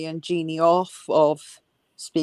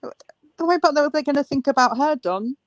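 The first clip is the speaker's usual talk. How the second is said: high-pitched, exasperated echo of the question; mocking incredulity; 8 semitones higher than usual. Co-host mocking what people will think about her, incredulous. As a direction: throw the worry back in a high, incredulous voice.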